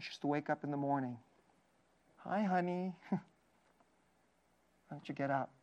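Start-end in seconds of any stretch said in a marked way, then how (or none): 2.2-5.5 tender. Retold or imitated. Pastor showing how a parent wakes a daughter, softly tender. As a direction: coax a sleepy daughter gently. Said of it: "It's extremely tender".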